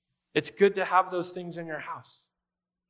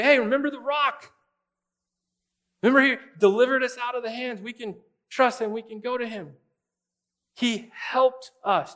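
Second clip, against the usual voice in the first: higher, eager reassurance to a struggling friend; encouraging reminder; 7 semitones higher, 4 dB louder than usual. Retold or imitated. Friend reminding a worried believer, higher and encouraging. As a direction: lift them up, remind them he came through. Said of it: "It's good for someone to say".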